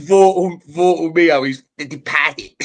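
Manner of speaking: put-on reading a chat message in a Boston accent; a Boston accent, loud and playful. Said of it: "I read that in the Boston accent".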